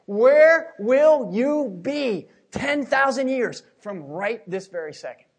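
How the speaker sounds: fiery preaching. Acted. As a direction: thunder it from the pulpit. Preaching at full force.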